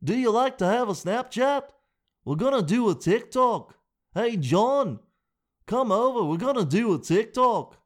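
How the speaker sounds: high, loud hammed-up rock star voice. Mocking [name] doing social media.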